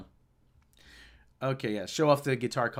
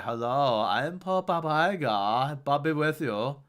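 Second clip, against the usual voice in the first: slow, drawn-out papal voice; Pope Bob Iger; slower than usual. Acted. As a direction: intone it like a pope giving a blessing. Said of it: "And then eventually he began".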